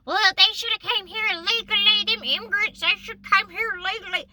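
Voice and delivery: high, loud mocking voice; loud, high-pitched caricature of complainers; mocking objectors. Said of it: "some people that are like".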